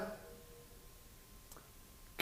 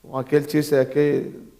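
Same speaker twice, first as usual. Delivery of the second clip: usual speaking voice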